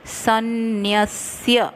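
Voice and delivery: सन्न्यस्य with half स joined to य, said slowly. Shown as a demonstration. Teacher drilling the conjunct in सन्न्यस्य for students. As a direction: sound सन्न्यस्य as san-nyas-ya, slow and exact. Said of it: "आधा स".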